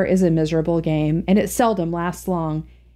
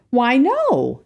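pleased surprise; 5 semitones higher than usual. A narrator's reading. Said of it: "exclaimed his father in pleased surprise".

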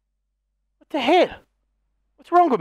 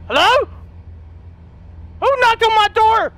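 calling out; 9 semitones higher than usual. Real, not acted. Scared gamer calling toward the hallway door. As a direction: call out high to whoever knocked.

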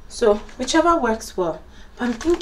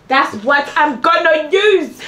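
drawn out higher and slower; higher, slow sing-song repeat; 8 semitones higher, 4 dB louder than usual. Acted. Repeating her plan in a drawn-out higher voice. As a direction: stretch it out, higher, like a catchphrase.

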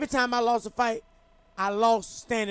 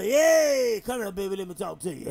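smooth ladies' man. Retold or imitated. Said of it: "had a".

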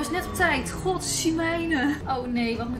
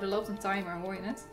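ordinary talk, nothing marked

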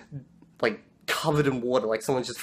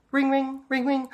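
high-pitched sung-out ringtone; imitated phone ring; 12 semitones higher than usual. Phone finally rings at the station, high imitated ringing.

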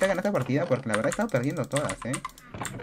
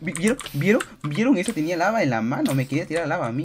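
high loud excited boasting; real thrilled shouting; 5 semitones higher, 5 dB louder than usual. Real, not acted. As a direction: brag to viewers after the kill. Excited after the kill.

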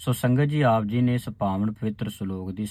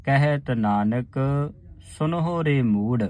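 ਹਰ ਹਰੇ ਹਰੇ ਭਾਜ said slowly with rests; slow recitation. Shown as a demonstration. Teacher showing the sangat how to say the line. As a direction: chant slowly, pausing at ਭਾਜ and Nanak. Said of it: "ਇਸ ਦਾ ਉਚਾਰਨ ਹੈ".

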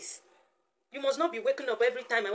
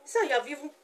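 ordinary delivery

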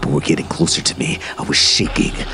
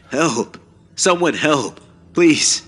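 high-pitched plea, rising in urgency; yelling for help; 11 semitones higher, much slower than usual. A narrator's reading. Runner escaped from the forest dead, yelling for help. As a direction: cry out high, without real volume. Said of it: "started yelling".

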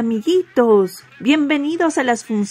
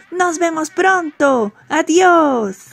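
high, bright puppet farewell; cheerful goodbye; 6 semitones higher, 4 dB louder, slower than usual. Acted. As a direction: sing out a big goodbye to the kids. Puppet host says goodbye to kids.

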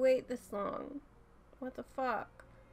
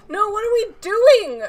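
loud, high protest, last word stretched out; distressed wail; 9 semitones higher, 15 dB louder than usual. Real, not acted. Viewer protests what the show is doing, distressed wail. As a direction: wail it at the screen, slowing on the repeat.

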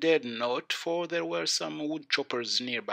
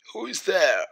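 loud, raised storybook wolf call; big wolf voice; 6 semitones higher, 5 dB louder than usual. A narrator's reading. Wolf in grandmother's bed calls out, big voice. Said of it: "the big voice of the wolf".

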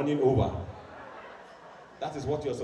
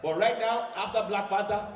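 normal talking voice

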